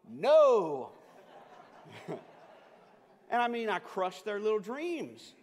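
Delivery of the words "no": firm no. Retold or imitated. Dad flatly refusing sons' plan.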